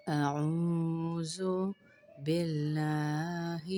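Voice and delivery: measured, drawn-out tajweed recitation; slow, careful Quranic recitation. Shown as a demonstration. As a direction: recite slowly with each word stretched. Recites taawwuz and basmala slowly.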